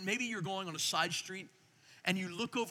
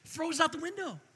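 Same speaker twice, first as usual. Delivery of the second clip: ordinary delivery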